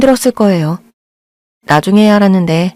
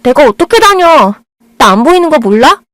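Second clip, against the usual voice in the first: much higher, louder, cutting retort; bitter outburst; 7 semitones higher, 3 dB louder than usual. Acted. Blind girl lashing out at the boy over school. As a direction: lash out in bitter hurt.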